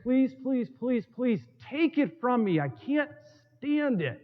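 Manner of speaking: preacher voicing Paul's desperate plea; begging plea. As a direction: beg as if it cannot be borne any longer. Paul begging the Lord.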